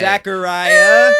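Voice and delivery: loud, drawn-out name call; over-the-top show host; hyped segment announcer. Pretend. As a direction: stretch the name into a big announcer cry.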